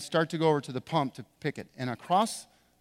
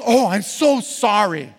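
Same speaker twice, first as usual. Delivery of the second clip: mock apology; 3 semitones higher, 7 dB louder, slower than usual. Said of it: "She goes".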